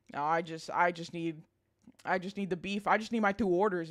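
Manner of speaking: ordinary talk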